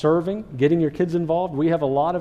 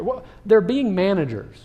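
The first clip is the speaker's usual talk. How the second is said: ordinary talk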